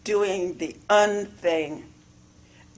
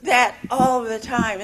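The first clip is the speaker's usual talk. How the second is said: son remembering; 5 semitones higher, 4 dB louder than usual. Retold or imitated. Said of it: "he said".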